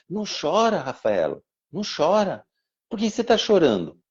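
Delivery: put-on a small boy gently soothing his sister; little boy comforting. Little son comforts crying sister. (menino pequeno consolando a irmã, voz aguda e suave)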